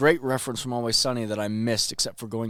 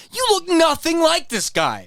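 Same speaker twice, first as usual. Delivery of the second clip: mock incredulous crowd; 6 dB louder, slower than usual. Quoted crowd in retold scene.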